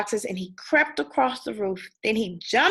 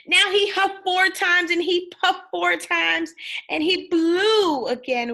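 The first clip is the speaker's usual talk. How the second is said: building effort; 8 semitones higher, 5 dB louder than usual. A narrator's reading. Wolf blows again.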